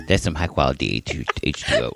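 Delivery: put-on dopey character voice, dropped in pitch; Bobby Boucher. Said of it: "we have fucking Bobby Boucher".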